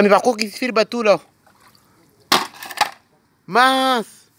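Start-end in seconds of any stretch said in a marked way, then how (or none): 3.5-4.3 surprised exclamation. Acted. Sees the stranded boat.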